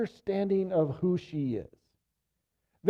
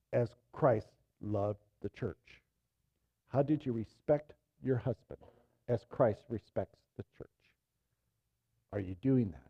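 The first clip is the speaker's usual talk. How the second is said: softer, lower pastoral close; soft earnest appeal; 8 semitones lower, 7 dB quieter than usual. Acted. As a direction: lean in and plead gently.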